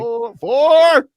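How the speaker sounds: loud high shouted four; mock golf shout. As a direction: bellow it like a golfer warning. Echoing the golf call.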